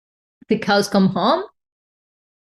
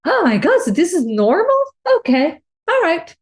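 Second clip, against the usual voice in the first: high, laughing mock relief at something so common; amused disbelief; 7 semitones higher than usual. Among friends laughing about husbands' phones, amused high disbelief. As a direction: act shocked, then laugh it off as normal.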